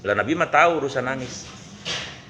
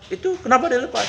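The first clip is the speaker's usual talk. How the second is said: indignant high demand; 11 semitones higher than usual. Acted. Hunter demands why deer freed.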